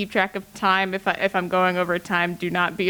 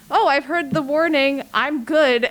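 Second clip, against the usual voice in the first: higher, mock-confident brush-off; breezy self-assurance; 7 semitones higher, 4 dB louder than usual. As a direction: play the listener waving off a warning. Acting out an overconfident listener.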